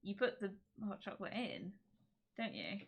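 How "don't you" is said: ordinary talk, nothing marked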